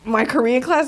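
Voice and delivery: ordinary delivery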